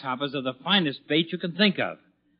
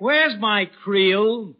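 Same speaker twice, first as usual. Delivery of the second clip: calling out; 6 semitones higher, 3 dB louder, much slower than usual. Acted. Husband calling to wife.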